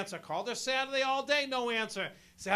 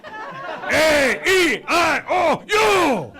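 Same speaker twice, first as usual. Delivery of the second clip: shouted vowels; 6 semitones higher, 12 dB louder, much slower than usual. Acted. Acting out uncle's vowels.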